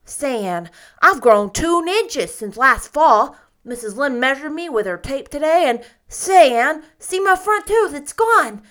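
put-on loud, high, tumbling boyish chatter; joyful child's yell; excited boy. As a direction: burst out like a thrilled little boy.

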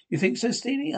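high, incredulous question; surprised question. Acted. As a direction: sound taken aback. Surprised reply about the warmth.

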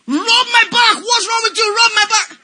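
high, loud, impatient command; demanding shout. Retold or imitated. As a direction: bark it out in pain. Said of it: "Mama said".

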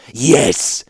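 forceful, drawn hiss of agreement. A narrator's reading. Young ruler seizing on the warlord's admission, hissing. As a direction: seize on it with venom. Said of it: "hissed the Kadir".

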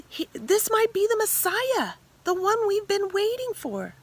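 the woman telling her village about Jesus, high and excited; breathless excitement. Acted.

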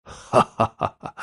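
slow, acted chuckle; light chuckle. A narrator's reading. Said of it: "年轻人微微一笑".

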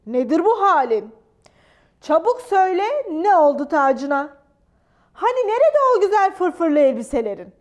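loud barrage of cross questions; hammed-up royal telling-off; thundering scolding. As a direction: fire questions like an angry king. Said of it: "Gürledi".

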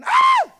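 sudden loud high-pitched shriek; terrified scream. Acted. Disciples sight a figure on the water, terrified scream. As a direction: scream out in alarm.

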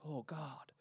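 Isaiah whispering his weakness before God; broken, fragile plea. A narrator's reading. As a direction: quietly confess your weakness, nearly breaking. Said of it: "so weak and so broken".

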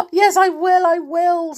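high, loud tearful yes; mild sobbing. A narrator's reading. Amelia agreeing to scrub her face, a touch of sobbing. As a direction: sob it out, sorry for herself. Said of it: "sobbed poor Amelia".

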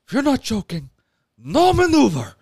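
loud high gangster bark; Al Pacino voice. Acted. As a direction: shout it like a movie gangster. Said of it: "He talks like this, like Al Pacino".

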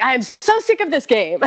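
loud, high-pitched imitation of fed-up husband; hammed-up eye-rolling complaint; mock exasperation. Imagining her husband tired of her doubts, mock exasperated. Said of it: "gonna roll his eyes and be like".

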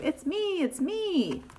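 lightly voiced high sheep. A narrator's reading. Unmasked sheep to the guessers, lightly voiced reveal. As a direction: softly lilt the reveal, slightly high. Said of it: "says the sheep".

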